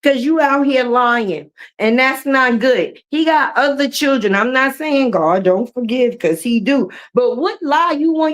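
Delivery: usual speaking voice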